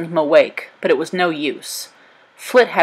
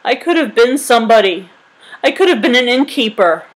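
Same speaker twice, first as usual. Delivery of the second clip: higher, louder lament; mournful, self-pitying cry; weeping; 5 semitones higher, 5 dB louder than usual. A narrator's reading. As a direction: innkeeper kneeling at the rubble, lamenting. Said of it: "knelt ... and wept".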